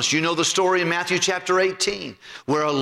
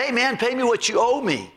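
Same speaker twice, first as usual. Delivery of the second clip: higher, sharp and demanding; demanding payment; 7 semitones higher than usual. Retold or imitated. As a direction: grab him and demand your money. Grabs debtor by the throat.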